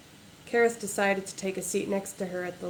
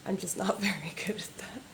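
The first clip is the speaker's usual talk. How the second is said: whispered aside; 6 dB quieter than usual. Real, not acted. Embarrassed aside about doing voices.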